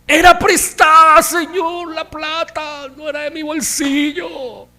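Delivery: high, loud desperate cry to the Lord; anguished cry. Acted. Young prophet cries to the Lord over a borrowed axe, loud and high. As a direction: cry out that the borrowed thing is lost.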